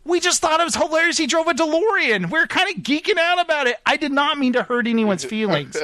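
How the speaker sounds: high-pitched, pleading retold apology; super apologetic. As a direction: plead that it was innocent, pitch up. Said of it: "Like, super apologetic".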